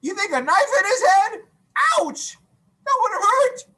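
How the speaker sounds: teacher pretending to be shocked by the answer; mock surprise. Real, not acted. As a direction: make a pretend wince for the class.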